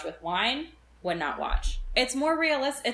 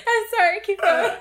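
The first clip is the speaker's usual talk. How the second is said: much higher, laughing through the words; 14 semitones higher, 8 dB louder than usual. Real, not acted. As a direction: apologize while still cracking up. Still laughing after the joke.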